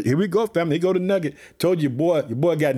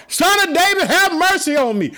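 shouted plea; 9 semitones higher than usual. Retold or imitated. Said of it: "he shouted all the more".

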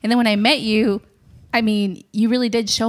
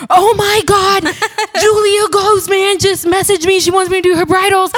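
much higher and louder, squealing; all-caps excitement; 10 semitones higher, 6 dB louder than usual. Retold or imitated. Texting her boyfriend about the famous photographer, squealing. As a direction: shriek it like a text in all caps. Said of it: "In all caps, I texted".

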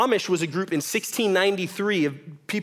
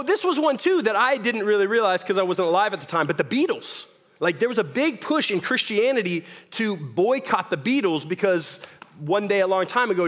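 ordinary talk

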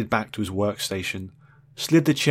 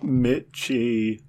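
mewling; 2 semitones higher than usual. Acted. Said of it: "he mewled".

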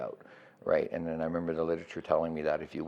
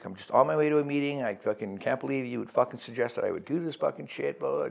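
higher-pitched mock griping; imitating yourself whining down the phone; bitching; 7 semitones higher than usual. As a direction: retell a rushed, sweary complaint. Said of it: "just bitching and bitching and bitching".

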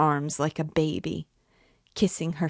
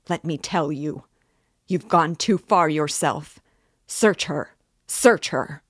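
loud, frantic outburst of a desperate woman; furious Katerina; 5 dB louder than usual. Acted. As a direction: hurl every word at them.

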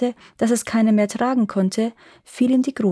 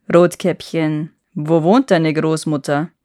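a hint of a low wolf; 4 semitones lower, 4 dB louder than usual. A narrator's reading. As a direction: ask as a wolf fishing for information. Wolf probes for the house.

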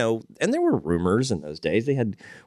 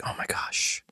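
breathy hushed gasp; whispered awe; 6 dB quieter, slower than usual. Retold or imitated. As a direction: awestruck whisper. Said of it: "you were like".

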